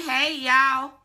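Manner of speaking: loud high "Hey, y'all"; cheerful greeting. Retold or imitated. Showing fans how to greet her, loud and high. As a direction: belt out a friendly hey.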